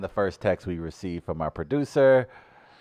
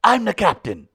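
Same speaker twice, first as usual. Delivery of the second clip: higher put-on Nigerian-style accent; Will Smith Concussion accent; 9 semitones higher, 4 dB louder than usual. Shown as a demonstration. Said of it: "No, say".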